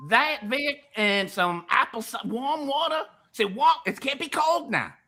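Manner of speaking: high, insistent country voice; hammed-up high country pitchman. Instructing Vic on warm water, high country voice. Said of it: "I'll do it in the country voice now".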